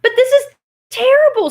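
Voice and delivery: very high, loud dismay; dismayed Tin Woodman. Acted. Tin Woodman learns Oz is a fraud, dismayed.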